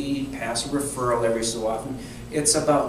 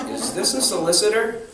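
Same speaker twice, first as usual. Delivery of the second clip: higher, wary, half-laughing challenge; suspicious question; 9 semitones higher than usual. Retold or imitated. Ruth asking the caller if he's selling, in a light mock suspicious tone. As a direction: sniff out the salesman.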